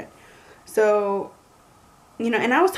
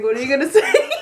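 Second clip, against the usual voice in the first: laughing impatience; 3 semitones higher, 7 dB louder, faster than usual. Retold or imitated. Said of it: "she was just like".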